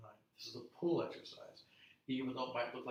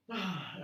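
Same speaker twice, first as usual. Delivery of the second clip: loud release sigh; 6 semitones higher, 6 dB louder than usual. Shown as a demonstration. Big breath out in stretch.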